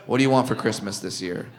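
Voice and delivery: soft resigned kindness; gentle to the kid. Retold or imitated. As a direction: play along softly for the kid. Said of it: "I go".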